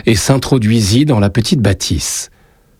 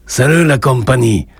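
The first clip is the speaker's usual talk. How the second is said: put-on a light Spanish accent, pitched up; 4 semitones higher than usual. A narrator's reading. Joseph greets the café as he enters, light Spanish accent. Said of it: "avec un accent qui dissimulait mal ses origines espagnoles".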